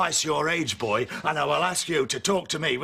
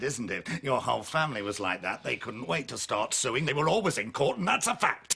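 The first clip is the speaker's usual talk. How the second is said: normal talking voice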